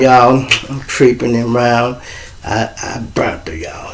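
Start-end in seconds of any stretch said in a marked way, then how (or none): 2.4-3.9 whispered, softer admission; confessing whisper. Real, not acted. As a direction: drop to a secret whisper. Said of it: "confessing secret".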